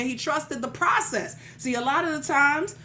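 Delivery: rising, rhythmic preaching with call-outs; a pastor rallying the congregation. Acted. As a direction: preach it and build to the peak lines.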